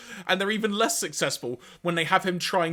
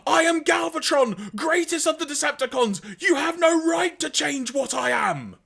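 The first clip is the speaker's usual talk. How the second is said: Galvatron; 11 semitones higher, 4 dB louder than usual. Acted. Decepticon leader defying his therapists, grandiose shout. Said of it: "Galvatron saying".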